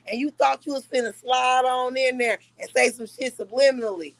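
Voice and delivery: usual speaking voice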